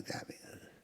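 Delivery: breathy dying whisper of grabbing others; hushed whisper of grabbing others. Real, not acted. Repetition fading to whisper.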